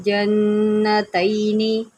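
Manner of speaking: जन्नतैनी with the double n humming; nasal nn in जन्नतैनी; ghunna. Shown as a demonstration. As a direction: hold the nn of जन्नतैनी in the nose. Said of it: "गुन्ना करें".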